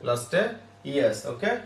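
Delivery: usual speaking voice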